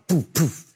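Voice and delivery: two puffed engine pops; imitated engine sputter. Preacher mimicking the other airplane engine, sputtering puffs. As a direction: mimic a second engine coughing out. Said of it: "you hear the other one".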